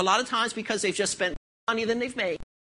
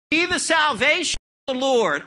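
shouted, higher, louder proclamation; booming God; 4 semitones higher, 4 dB louder than usual. Acted. Preacher voicing God to Moses as a booming shout. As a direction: thunder out God's promise. God's promise to Moses climaxing.

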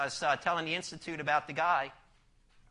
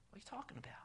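whisper; 3 semitones higher, 21 dB quieter, much faster than usual. Acted. Mock shocked reaction to robber.

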